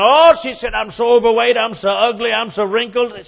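high, loud whine about her looks; hammed-up wife fishing for sympathy; whiny wife. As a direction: wail to the mirror in self-pity. Wife lamenting at mirror.

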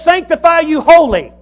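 loud, high proclamation; forceful scripture quote. Acted. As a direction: ring it out like scripture.

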